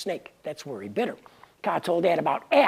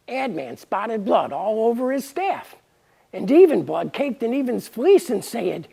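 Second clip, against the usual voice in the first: ordinary delivery, nothing marked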